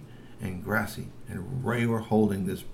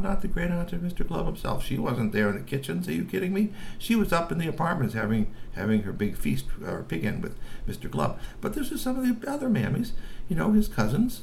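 plain reading voice

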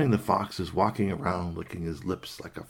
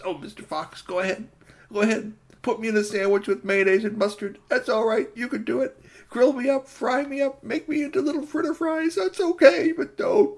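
high voice climbing higher and louder; frantic rabbit; 5 dB louder than usual. Acted. Rabbit offering himself as food, frantic high pleading. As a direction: build to a high, desperate babble. Rabbit urges fox to cook him.

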